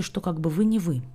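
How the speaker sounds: usual speaking voice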